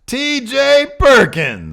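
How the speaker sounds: slow, loud, stretched-out name call; announcer call. Acted. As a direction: draw the name out like a ring announcer. Announcing the next countdown entry.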